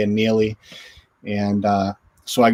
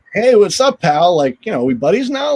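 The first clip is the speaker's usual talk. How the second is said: higher and louder, hammed-up buddy call; mock cocky greeting; 6 semitones higher, 4 dB louder than usual. Goalie retelling how he called back to Rob Blake, higher, louder, mock cocky. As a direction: play it like he is suddenly Blake's pal.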